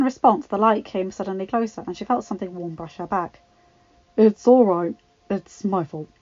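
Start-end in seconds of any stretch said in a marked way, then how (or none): 4.2-6.1 teasing with a smirk; amused, gently mocking. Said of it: "a smirk evident in his voice".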